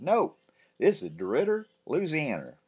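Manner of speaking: put-on chirpy little boy; cheeky boy. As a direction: pipe up like a know-it-all kid. Ben answering.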